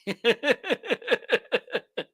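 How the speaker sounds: high, falling chuckle; a long run of giggles tailing down; laugh. Real, not acted. Laughs after answering Tommy.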